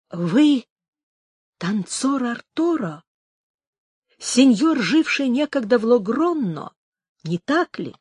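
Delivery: understated agitation, slow and halting; trembling with emotion. A narrator's reading. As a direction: ask haltingly, a touch of nervous emotion. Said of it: "дрожащим от волнения голосом". (с лёгкой дрожью волнения в голосе)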